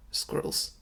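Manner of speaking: barely voiced, hushed murmur; quiet murmur. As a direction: murmur it under your breath. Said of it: "Stu quietly murmurs".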